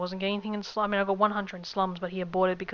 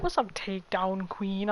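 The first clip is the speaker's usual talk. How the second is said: slow, lightly deepened quote of a streamer's greeting; a hint of a deep-voiced man saying hi; imitating Hellbent; 3 dB quieter, slower than usual. Streamer imitates Hellbent's greeting to her, lightly deepened and slow. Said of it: "I always loved men with deep voices".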